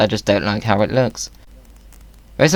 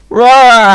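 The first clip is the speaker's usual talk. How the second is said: mock roar; 12 semitones higher, 10 dB louder than usual. Roaring at spawn protection.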